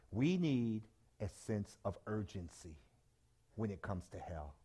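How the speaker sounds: soft, slow and grave; solemn low. Real, not acted. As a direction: drop the voice for weight. Solemn point on hell.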